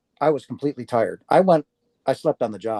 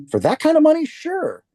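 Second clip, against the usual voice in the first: high, loud leap on the money; astonished agreement; 4 dB louder than usual. Retold or imitated. Reliving being asked to relocate for big money, astonished. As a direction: soar up as if it's a no-brainer. Retells jumping at money offer.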